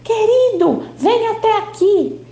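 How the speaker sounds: mother calling her husband for help, high alarmed shout. A narrator's reading. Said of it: "E ela começa a gritar".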